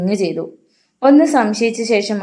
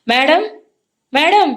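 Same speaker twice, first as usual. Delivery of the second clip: higher, louder calling; calling out loudly; 8 semitones higher, 3 dB louder, slower than usual. A narrator's reading. Rosie searches for the missing madam, calling out. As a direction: call for her through the house. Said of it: "അവൾ ഉറക്കെ വിളിക്കുന്നത്".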